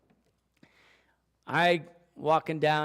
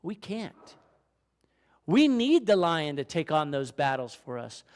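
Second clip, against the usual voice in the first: ordinary delivery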